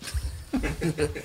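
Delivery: quiet short laugh; a little chuckle; soft laugh. Real, not acted. Laughs at joke.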